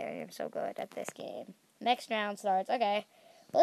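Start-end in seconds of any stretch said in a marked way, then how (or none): 0.0-1.5 whisper. Real, not acted. Whispered smug boast.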